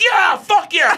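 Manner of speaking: high, loud whoop of approval; cheering. Retold or imitated. Watching his own movie, he cheers himself on loudly. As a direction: cheer it like a fan in the seats. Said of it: "And then I'm like".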